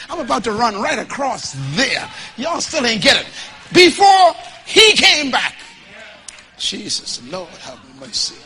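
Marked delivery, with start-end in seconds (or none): 3.7-5.5 high, loud and slow; preaching shout. Acted. Preacher shouting to the church. 6.6-8.4 soft, low "Lord, have mercy"; hushed exclamation. Real, not acted. Preacher overcome before the church, quiet and low.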